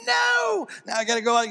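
a sudden high yell at the closed road; mock frustrated shout. Road rage driver yelling at a closed road, mock shout. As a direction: blow up at the detour.

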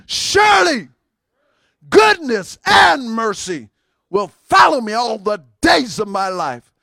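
full-force declaration of faith; declared with conviction. Shown as a demonstration. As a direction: shout it like you mean it. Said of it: "Say it like you mean it".

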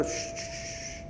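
whispered shh, shh, then a soft low tranquilo; calm a startled child with a whisper; gentle whispered shushing; soothing hush. Retold or imitated. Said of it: "tiene al Señor diciendo".

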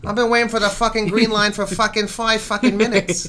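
high-pitched exasperated Boston commuter; Boston accent. Shown as a demonstration. Host playing a commuter waiting for the green line, Boston accent. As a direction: complain in a broad, sweary Boston accent. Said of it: "same thing for Boston".

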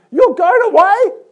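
high, loud cry of disbelief; shocked disbelief. Acted. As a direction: blurt it out, stunned. Peter's shock at Jesus leaving.

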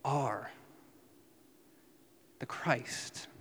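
slow, fading to unvoiced; the priest's words half-whispered; quiet irony. Shown as a demonstration. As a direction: let it drop to a breath. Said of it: "Mark's irony, where Caiaphas is saying".

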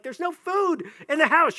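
mock hangry complaint, voice lifted; grumbling. Hungry person complaining at home, grumbling. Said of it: "You're grumbling".

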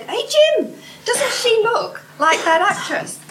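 high, loud call to her husband; excited lady. Retold or imitated. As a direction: nudge your husband, thrilled.

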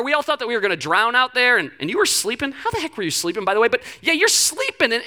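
usual speaking voice

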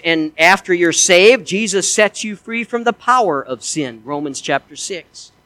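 usual speaking voice

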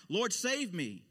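loud, high cry for help. A narrator's reading. Peter beginning to sink in the wind, crying out. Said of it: "cried out".

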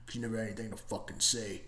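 lightly acted brooding comeback, rushed and hushed; imitating Bradley Cooper. Reviewer quoting the brother fight, low and quick mock growl. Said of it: "And then Bradley Cooper's like".

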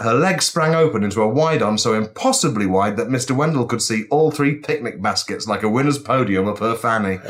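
ordinary delivery

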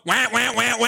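repeated mock horn blasts; imitated car horn. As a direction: honk like a car horn, insistently. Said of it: "you blowing the horn".

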